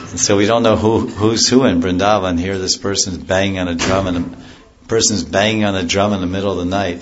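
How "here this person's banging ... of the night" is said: ordinary talk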